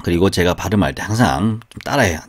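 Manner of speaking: ordinary talk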